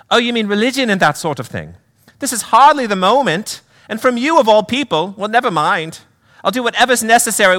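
high, impatient British lady; Pam. Acted. Mother impatient to see her boy, high British accent. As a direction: brush it off in a high British woman's voice. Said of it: "do a little accent work".

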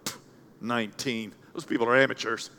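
lady after first service scoffing. Retold or imitated. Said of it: "a lady stop me and go".

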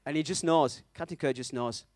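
usual speaking voice

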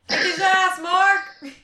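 loud rallying cry; cheering shout. Real, not acted. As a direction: shout it out to egg him on. Cheering Mark on.

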